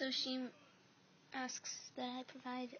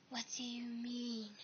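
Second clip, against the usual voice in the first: ordinary delivery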